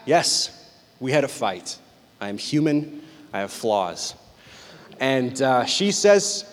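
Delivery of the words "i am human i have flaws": usual speaking voice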